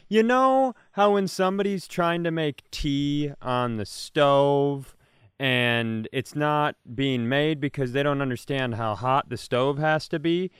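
ordinary talk, nothing marked

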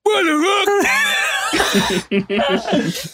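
high, slow, drawn-out disbelieving yell; shocked outburst. Acted. Father catching them, yelling in drawn-out shock. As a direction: stretch it out in stunned shock. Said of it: "creaks open the door and goes".

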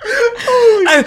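cracking up mid-sentence; squealing laughter; laughing hard. Real, not acted. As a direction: laugh through the words. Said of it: "to be fair".

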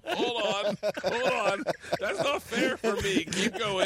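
high-pitched, through real laughter; laughing protest. Real, not acted. Comic caught out by narcissism quiz, laughing hard as he protests.

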